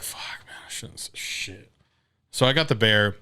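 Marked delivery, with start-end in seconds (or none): 0.0-1.7 whispered worry. Retold or imitated. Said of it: "And I'm just there like".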